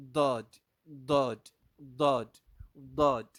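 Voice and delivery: loud, punched "Dod" with a heavy DH-like D; emphatic "Dod", unlike plain D; Arabic Daad demo. Shown as a demonstration. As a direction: hammer out each "Dod", tongue sides on the teeth. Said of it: "But this is Dod".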